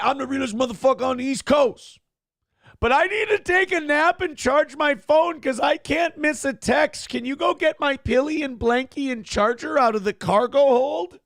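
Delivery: high, whiny mock baby voice; mock the grown man whining for his blankie; whiny man-child. Comedian mocking the passenger, high and whiny.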